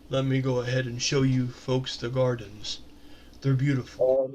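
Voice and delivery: lightly voiced Yakon, gentle host. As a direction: offer it low and unhurried, lightly in character.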